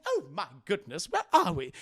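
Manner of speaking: hint of a fussy droid voice; lightly voiced bewildered protocol droid; C-3PO. Droid waking among Ewoks, fussy bewilderment.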